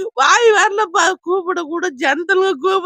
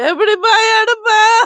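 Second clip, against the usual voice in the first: despairing cry; 6 semitones higher, 5 dB louder than usual. Real, not acted. Despairing over lost gold.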